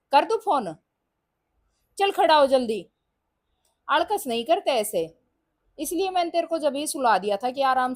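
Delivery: very loud, high exasperated nagging; loud scolding. Real, not acted. Mother loudly scolding son to leave for tuition. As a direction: drive him out the door.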